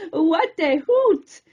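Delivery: slow giggly exclaim; laughing at the owl, slow and giggly; giggling. Acted. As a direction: giggle through it. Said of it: "giggled Queenie".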